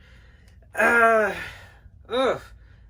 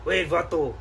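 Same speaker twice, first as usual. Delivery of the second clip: put-on laid-back Chicano street accent, ese and vato; 2 semitones lower than usual. Collector interrupts.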